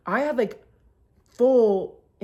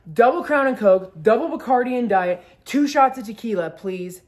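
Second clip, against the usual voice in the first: imitating a forceful bar order; emphatic drink order; 3 semitones higher, 7 dB louder than usual. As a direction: fire off the order loudly. Date barks order before she speaks.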